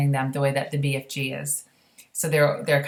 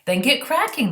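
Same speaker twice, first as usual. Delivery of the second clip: a higher, commanding royal voice; brisk queen; 8 semitones higher, 4 dB louder than usual. Acted. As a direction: give the order like a queen. Queen commanding the Air Force.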